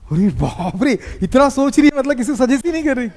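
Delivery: high, quick teasing disbelief; mock astonishment. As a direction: tease with exaggerated amazement. Teasing a student's hesitant answer.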